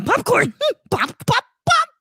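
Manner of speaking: creepy clown calling down the sewer; Pennywise impression. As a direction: lure him in with a creepy high clown voice. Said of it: "Pennywise luring Kermit".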